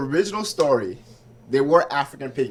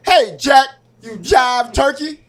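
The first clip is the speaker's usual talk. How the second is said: higher, louder mock seventies jive talk; jive slang voice; 7 semitones higher, 5 dB louder, slower than usual. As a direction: ham up the old street slang, drawn out slow. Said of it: "they didn't talk like that".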